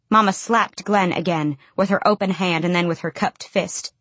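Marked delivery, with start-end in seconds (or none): none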